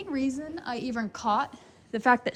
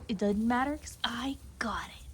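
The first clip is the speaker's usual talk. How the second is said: whispery, softer horse reply; horse's voice; 7 dB quieter than usual. Retold or imitated. Vlogger voices the unbothered mare, in a soft whisper. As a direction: whisper it as the mare talking back. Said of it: "and she's like".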